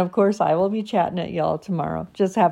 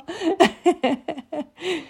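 high giggling burst; high laugh; 11 semitones higher, 3 dB louder than usual. Real, not acted. Laughing after voicing her dog, high giggle. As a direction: laugh up high at your own joke.

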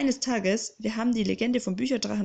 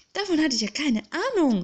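higher and louder, breathless; excited gasp; 8 semitones higher, 6 dB louder than usual. Acted. Boy thrilled by secret gang, gasping higher and louder. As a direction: blurt it out, caught breathless. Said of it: "keuchte ich".

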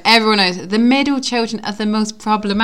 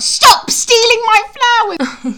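high, drawn-out mock yell; imitated angry neighbour; 14 semitones higher, 3 dB louder, slower than usual. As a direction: act out a scolding flower owner. Quotes neighbour catching flower thief.

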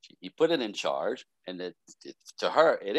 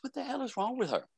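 much higher, baffled and whiny; exasperated friend; 13 semitones higher, 6 dB quieter than usual. Retold or imitated. Friend complaining to host about his girlfriend, exasperated. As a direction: throw up your hands in disbelief.

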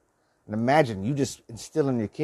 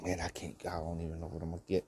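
quick soft mutter; muttered under breath; 5 semitones lower, 13 dB quieter, faster than usual. Retold or imitated. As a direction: mumble it fast to yourself. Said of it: "I was like".

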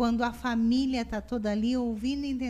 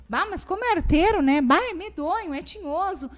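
higher, louder mock tutting; hammed-up nosy neighbour; imitating an outsider scolding the child; gossipy disapproval; 5 semitones higher, 6 dB louder than usual.

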